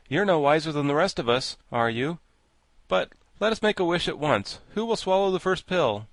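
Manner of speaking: lightly acted challenge; rally the party into action; lightly voiced Scarecrow, brisk retort. A narrator's reading.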